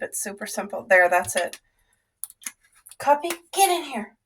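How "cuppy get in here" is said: high, sing-song call to a pet. Real, not acted. Calling the pet in.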